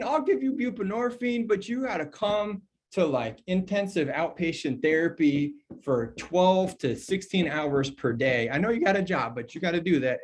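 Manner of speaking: mock a provider piling on conditions; gatekeeping provider. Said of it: "I mean".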